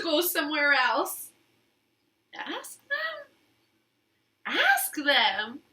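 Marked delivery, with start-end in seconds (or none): 2.3-3.3 high, soft little voice; Piggy quietly suggesting; Piggy's suggestion. Acted. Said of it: "said Piggy".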